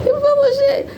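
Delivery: high, amused accusation; high incredulous callout. Real, not acted. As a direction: squeal it out in disbelief. Calling out a creator's lie.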